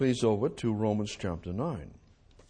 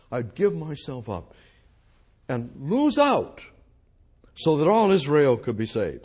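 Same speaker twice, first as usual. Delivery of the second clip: higher, louder pleading voice; impassioned cry; 4 semitones higher, 6 dB louder than usual. Acted. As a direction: cry it out as Paul's anguish. Preacher voicing Paul's anguish.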